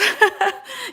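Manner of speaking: quick high rising laugh; high giggle. Real, not acted. Laughs greeting viewers.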